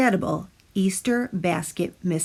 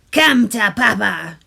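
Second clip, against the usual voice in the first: louder greedy tooth call; shouting tooth; 6 dB louder than usual. A narrator's reading. Tooth shouts at the cookies, loud and greedy. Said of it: "shouted the tooth".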